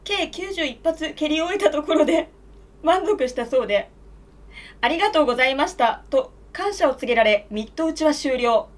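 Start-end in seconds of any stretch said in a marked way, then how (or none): none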